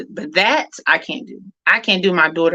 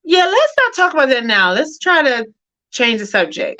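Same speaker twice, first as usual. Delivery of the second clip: deflecting mom; 7 semitones higher, 4 dB louder than usual. Retold or imitated. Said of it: "I'll say, um".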